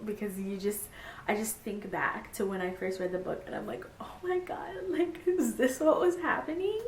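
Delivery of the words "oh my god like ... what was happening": high-pitched incredulous gasp of a question; squeaky-high mock astonishment; retold disbelief. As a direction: relive the shocked discovery in a high, light voice. Acts out her first reaction to book.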